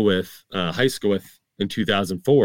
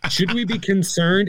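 high, anxious question; mock concern; 11 semitones higher than usual. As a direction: fret like a bewildered old-timer. Acting out alarm at loud music.

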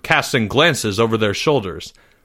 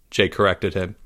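ordinary delivery

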